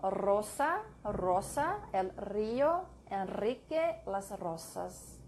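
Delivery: rolled R starting "Rosa" and "río"; Spanish tongue trill on each R of "rosa". Shown as a demonstration. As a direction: roll the R in "Rosa, río, Enrique, rosas". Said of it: "N, or S is trilled".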